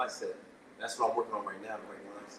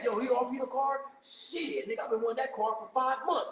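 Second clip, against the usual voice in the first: higher, aggrieved neighbor voice; jealous neighbor; 11 semitones higher than usual. Retold or imitated. Neighbor down the street complaining about the car, higher voice. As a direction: complain like you were robbed.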